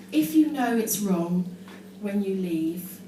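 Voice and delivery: slow, mock-solemn poem recital; poem reading. Shown as a demonstration. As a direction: read it like a deadly serious poet. Reads own teenage poem solemnly.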